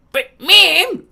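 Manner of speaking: high, loud whiny cartoon kid; Cartman. Shown as a demonstration. Demonstrating a character's original voice, high and loud. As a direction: pitch it up into a cartoon whine. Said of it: "his original, like".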